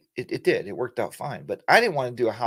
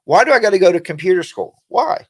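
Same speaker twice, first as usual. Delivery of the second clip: louder, higher mock whine; mock complaint; 6 semitones higher, 8 dB louder than usual. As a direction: scoff at school as if it were pointless. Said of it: "you think".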